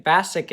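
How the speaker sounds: "Basic" said as bassick, short A as in bat; short-A mispronunciation. Shown as a demonstration. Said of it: "Basic isn't a word".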